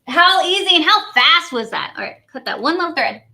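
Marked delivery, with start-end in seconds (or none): 0.1-1.9 high, loud, drawn-out delight; excited gushing. Real, not acted. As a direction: exclaim it slowly, punching each HOW. Gushing over the finished card.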